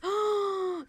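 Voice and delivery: sharp high gasp of alarm; shocked gasp. Real, not acted. Hearing a battery-free Furby still talks, real shocked gasp. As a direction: gasp at something creepy.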